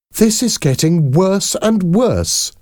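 high, slow exasperation; appalled exclamation. A narrator's reading. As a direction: draw it out in high-pitched dismay. Debbie appalled at the tights story.

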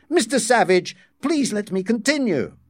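high, pleading politeness; placating appeal. A narrator's reading. Deputy director trying to calm savage, pleading. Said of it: "trying to calm him".

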